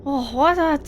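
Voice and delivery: high, stammering, rattled; frightened character. Acted. Zolog explaining the voice in his head, rattled. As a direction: babble in high-pitched distress.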